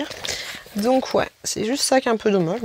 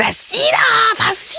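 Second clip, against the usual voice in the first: hammed-up urging; 12 semitones higher than usual. Urging herself to buy a pricey brush, high mock shout. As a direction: goad yourself to go for it, pitched high.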